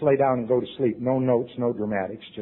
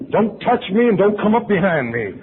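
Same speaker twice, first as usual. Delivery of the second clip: loud, high warning; laying down the law to the group; tough guy rules; 9 semitones higher, 9 dB louder than usual. Retold or imitated. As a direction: bark the rules at everyone. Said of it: "I had two rules".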